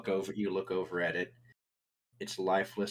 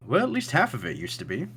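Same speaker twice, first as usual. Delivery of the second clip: higher, swooping character voice; Thatch; 8 semitones higher, 3 dB louder than usual. Acted. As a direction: lift it into a wry host's correction. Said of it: "Thatch looks over at it and says".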